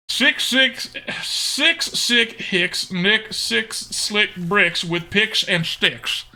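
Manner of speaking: put-on tongue twister in a slow Texan accent. Streamer takes on a challenge from chat, slow Texan accent. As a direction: pick through each word with a deliberate Texan twang. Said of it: "give me something challenging to say".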